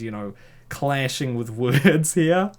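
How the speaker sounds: usual speaking voice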